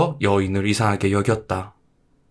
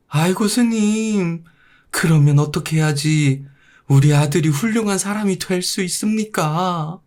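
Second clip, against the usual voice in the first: pleading mother; 9 semitones higher than usual. Acted. Mother begging the monk to save her son, high wailing plea. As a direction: beg the monk with a high desperate cry.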